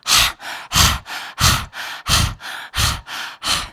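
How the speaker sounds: forceful panting out over the tongue; loud rapid huffing breaths, tongue out; lion's breath. Shown as a demonstration. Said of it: "Lion's breath".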